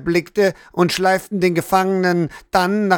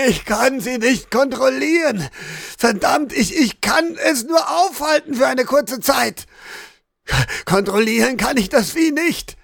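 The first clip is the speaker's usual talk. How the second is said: high, strained outcry with stumbling words; desperate protest; 6 semitones higher than usual. A narrator's reading. Beaten prisoner denying he controls the beast, desperate. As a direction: plead it urgently, as if under attack.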